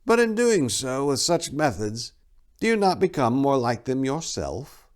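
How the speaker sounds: speculative tone. A narrator's reading. Abbot Gelfus questioning Tor's methods, speculative. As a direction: muse on it, probing gently. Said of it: "His tone speculative".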